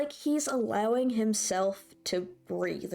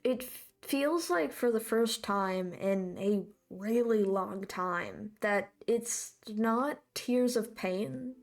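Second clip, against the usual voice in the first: ordinary talk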